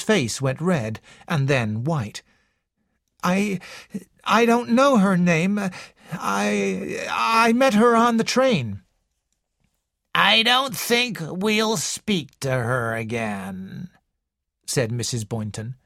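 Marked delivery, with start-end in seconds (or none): 3.2-8.8 higher, flustered stammering; nervous son stammering an answer to his mother. A narrator's reading. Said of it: "Raymond jumped nervously". 10.1-13.9 put-on slow, wheezy old woman's voice; slow wheezing invalid complaining to a visitor; wheezing old lady. A narrator's reading. Mrs Boynton's voice.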